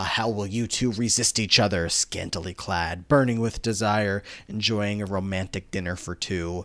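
low, gloating villain voice; evil distorted villain. Acted. Sue taunting the boys about resisting each other, evil villain voice. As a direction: savor the taunt as a hammed-up villain. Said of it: "in this very evil, distorted voice".

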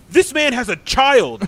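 high, slow, hammed-up outrage; imitated indignation. Imitated woman scolding man who tipped nothing, indignant. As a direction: draw it out as a mock reproach.

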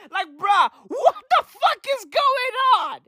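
high-pitched, hammed-up disbelief; incredulous outburst. Comedian ranting at absurd vaccine news, high incredulous cry. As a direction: squeal it in comic disbelief. Incredulous rant after the impression.

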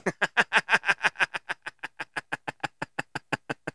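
long amused laugh. Real, not acted. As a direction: laugh at your own joke. Laughs at own joke.

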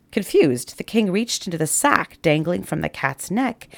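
ordinary delivery, nothing marked

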